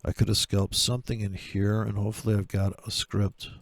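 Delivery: ordinary talk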